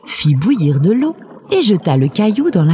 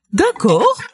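accord rieur, plus aigu; réponds d'un ton amusé, voix montante; en riant; 10 semitones higher than usual. A narrator's reading. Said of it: "répondit en riant". (d'accord léger, un soupçon de rire)

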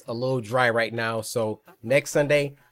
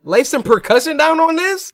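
much higher, excited squeak; squeaky question; 14 semitones higher, 5 dB louder than usual. Acted. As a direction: pop up high. Starting a rap.

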